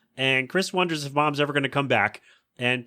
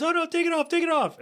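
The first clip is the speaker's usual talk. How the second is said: very high panicked squeal; hammed-up panicking snowman; mock panic. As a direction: shriek for them to remove it. Frosty burning, panicking.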